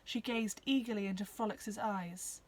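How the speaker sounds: plain reading voice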